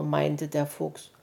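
ordinary delivery

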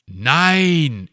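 sharp, loud no that slips out; startled blurt of refusal. Acted. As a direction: snap the word out before you can stop it. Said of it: "entfuhr es ihm".

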